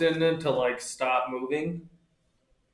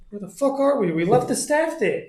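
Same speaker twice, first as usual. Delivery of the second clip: startled; 9 semitones higher than usual. Retold or imitated. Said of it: "I'm like".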